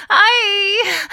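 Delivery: loud, very high wail of pain. A narrator's reading. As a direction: wail out as a cramp hits. Lydia moans with cramps.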